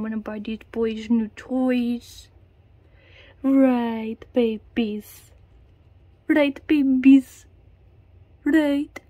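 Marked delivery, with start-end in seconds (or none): 3.4-9.0 loud pet call. Real, not acted. Owner calling out to her rats, loud and cooing. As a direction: sing it out to the babies.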